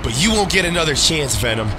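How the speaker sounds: indignant hero's outburst; angry confrontation. Acted. Hero confronts betrayer in anger.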